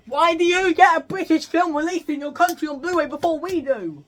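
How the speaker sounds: loud, high-pitched indignant outburst; worked-up aggrieved shouting; exasperated rant. Real, not acted. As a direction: yell the complaint in outrage. Rants about overseas release dates.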